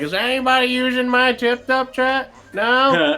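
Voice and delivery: put-on Southern accent. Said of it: "I like how he became Southern".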